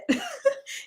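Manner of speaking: short burst of high laughter. Real, not acted. Laughs at her own bluntness.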